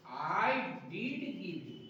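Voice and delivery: very slow, did pressed; I did give you, did stressed; emphatic did. Shown as a demonstration. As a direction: insist with did, dictating slowly. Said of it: "तो इसको करना पड़ेगा".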